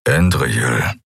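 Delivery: low-pitched gruff rumble; brooding male growl, understated; low grumble. A narrator's reading. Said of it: "brummte er".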